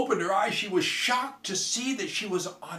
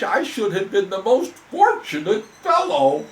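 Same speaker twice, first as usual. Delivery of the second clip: loud, higher, dramatic frog complaint; lamenting frog; 8 semitones higher, 10 dB louder than usual. Acted. Frog complaining to the girl, loud and dramatic. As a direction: wail it like a theatrical frog.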